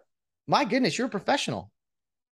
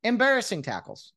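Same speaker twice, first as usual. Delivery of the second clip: ordinary delivery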